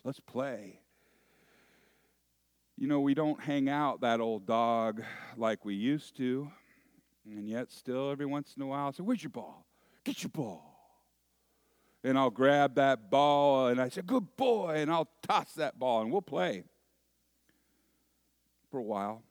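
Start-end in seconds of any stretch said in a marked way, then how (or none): 0.0-0.8 soft, lightly voiced old dog; understated talking-dog voice. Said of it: "he wags his tail, seemingly say". 9.0-10.7 soft, teasing pet talk; lightly acted dog-owner voice; playing with a dog. Urging the dog to fetch. 14.0-14.8 high, quick pet praise; acted dog-owner delight; praising a dog. Praises the dog during fetch.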